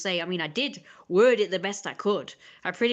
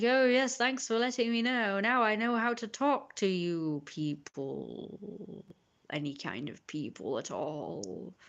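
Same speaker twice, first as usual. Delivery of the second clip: mock thanks; 4 dB quieter, slower than usual. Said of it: "I was being a little bit sarcastic".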